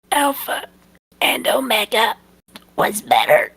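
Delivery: very high squeaky dying voice, dropping at the end; squeak. Acted. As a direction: squeak it out with the last breath. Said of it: "he squeaks".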